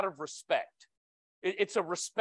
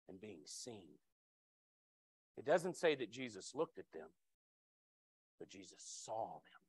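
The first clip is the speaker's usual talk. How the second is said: much softer and lower, near a whisper; hushed reverent; 9 semitones lower, 12 dB quieter than usual. Real, not acted. Preacher savoring that Jesus saw them, hushed. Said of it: "hushed emphasis on seen".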